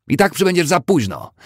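very high, loud taunting cry; shouting after him. A narrator's reading. Woman on the veranda to departing Charles, high shout. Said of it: "krzyknęła, gdy znikał w oddali".

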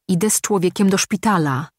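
podniesiony głos, stanowczo; krzyk. Acted. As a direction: krzyknij to żołnierzowi w twarz. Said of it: "krzyknął". (krzyk, ale bez przesady)

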